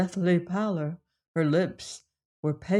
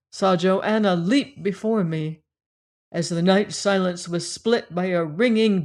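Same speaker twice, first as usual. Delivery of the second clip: plain reading voice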